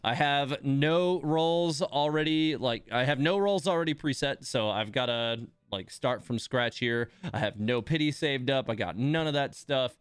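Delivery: usual speaking voice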